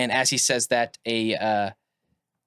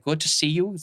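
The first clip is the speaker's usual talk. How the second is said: a drifting, higher put-on accent, half German; shifting accent; 5 semitones higher than usual. Inventor greeting his friend Joan in a shaky German accent. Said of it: "His accent has changed".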